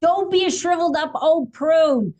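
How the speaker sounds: high, loud playful scold; mock scolding. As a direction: scold like a fussy aunt. Mock scolding about shriveled prune.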